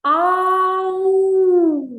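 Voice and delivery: Ау called high and loud; girl calling out. Acted. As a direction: call Ау as a lost girl. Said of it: "щоб прочитати". (гучне високе «Ау», як дівчинка кличе)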